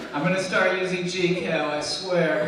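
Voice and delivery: hammed-up tearful begging; mock pleading. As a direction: beg like a kid about to be thrown out. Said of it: "I'm pleading with them. I'm crying".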